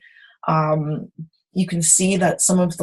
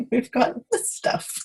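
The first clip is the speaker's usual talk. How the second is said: higher, amused repeat through a chuckle; laughing; 6 semitones higher, 4 dB quieter than usual. Real, not acted. Tutor repeating herself to viewers mid-demo, laughing. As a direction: chuckle while saying it.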